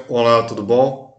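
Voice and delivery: mock a thickened, deep voice; a hint of a demonic deep voice. Shown as a demonstration. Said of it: "essa voz de demônio".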